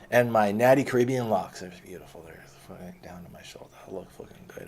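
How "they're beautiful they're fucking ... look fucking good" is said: soft, breathy brag as an aside; whispered aside. Real, not acted. Whispering an aside to the audience about his good-looking locks.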